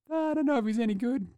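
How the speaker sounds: high, put-on mock doubt; doubting inner voice. Fan watching the end of the game, high mock doubting voice. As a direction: pitch it way up, a small unsure thought. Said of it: "you're like".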